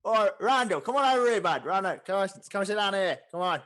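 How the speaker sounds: high, loud calling out; loud orc innkeeper. Acted. Orc barkeep waves newcomer to a stool, hollering. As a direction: holler him over to the bar.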